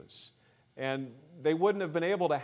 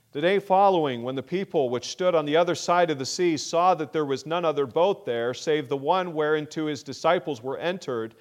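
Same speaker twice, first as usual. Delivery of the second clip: loud scripture reading; 3 semitones higher, 8 dB louder than usual. Acted. Preacher reads the verse to the congregation, loud and proclaiming. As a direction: read the verse out loud, with full preaching force.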